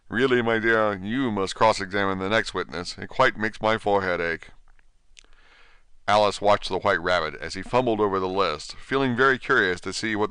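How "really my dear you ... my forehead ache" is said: ordinary delivery, nothing marked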